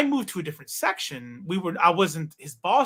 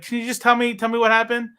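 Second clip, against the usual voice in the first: higher, louder anxious plea; retold alarm; 5 semitones higher, 5 dB louder than usual. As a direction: press for news, acting worried. Retold alarmed reply to crying mom.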